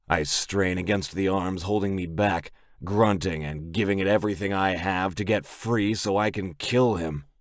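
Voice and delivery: plain reading voice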